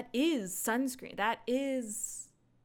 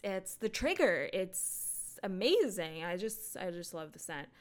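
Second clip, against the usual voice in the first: usual speaking voice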